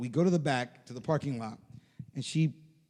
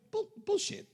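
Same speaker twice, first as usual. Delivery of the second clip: disbelief; 4 dB quieter than usual. Retold or imitated. Disbelieving a stranger's claim.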